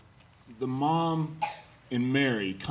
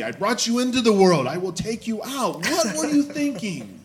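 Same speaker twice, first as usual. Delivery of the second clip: Bill Cosby; 9 semitones higher, 4 dB louder than usual. Retold or imitated. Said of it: "Kind of the old Bill Cosby".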